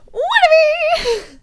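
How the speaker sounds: high, slow squealed whatever through laughter; a flustered laughing squeal. Real, not acted. Laughing squeal about being called out.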